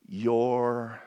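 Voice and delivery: ordinary delivery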